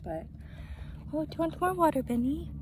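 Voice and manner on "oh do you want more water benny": high sweet voice to a dog; pet talk. Real, not acted. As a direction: coo it to the dog. Talking to her dog.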